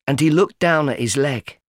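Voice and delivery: ordinary delivery, nothing marked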